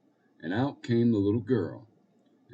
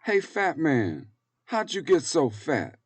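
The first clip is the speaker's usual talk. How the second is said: put-on high, louder little-girl voice; cheeky little girl; 8 semitones higher, 6 dB louder, slower than usual.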